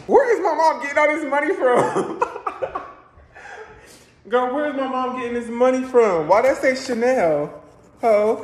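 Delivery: high, loud laughing shock; can't believe mom bought Chanel; laughing disbelief. Real, not acted.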